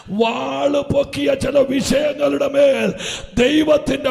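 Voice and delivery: high, loud, partly chanted proclamation; preacher prophesying and speaking in tongues, loud and slow; fiery prophetic preaching. Acted. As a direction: command deliverance over the crowd.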